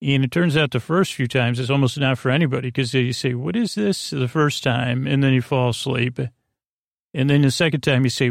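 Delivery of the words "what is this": puzzled. Retold or imitated. Puzzled new listener quoted.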